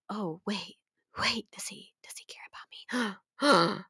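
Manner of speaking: whispered inner thought. Retold or imitated. Voicing the heroine's hopeful doubt in a soft whisper. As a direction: breathe it like a secret hope.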